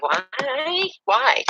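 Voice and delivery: high, startled double why; baffled why. Retold or imitated. Teen girl baffled by a stranger's gift, high startled why. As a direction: squeak it out in disbelief. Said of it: "And I was like".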